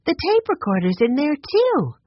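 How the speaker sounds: high-pitched amazed report; excited discovery. A narrator's reading. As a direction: exclaim it, rising high on the last word. Girl points out startling find.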